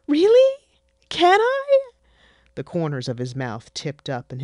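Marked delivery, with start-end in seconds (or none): 0.1-1.9 put-on very high, loud eager child voice; thrilled little boy; excited child. Son thrilled at his mother's idea.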